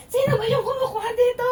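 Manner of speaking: high angry yell, close to tears; furious shout. Retold or imitated. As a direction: shout it out in rage, voice cracking. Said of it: "Galit na galit talaga siya". (galit na sigaw)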